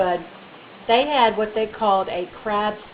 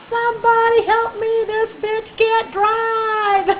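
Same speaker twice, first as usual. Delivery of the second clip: high, drawn-out mock cry for help; retold screaming; 12 semitones higher, slower than usual. Wheelchair rider to passersby, mock screaming for help. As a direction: wail it high, stretching the last word. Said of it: "I kept screaming".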